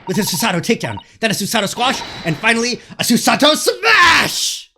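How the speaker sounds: rising to a full war cry; building battle cry. Acted. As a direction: move names building to a roaring finish. Building fighting-move list.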